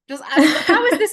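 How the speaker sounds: loud, slow, high disbelief with a laugh; laughing outrage; exasperated. Real, not acted. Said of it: "angry even thinking about it".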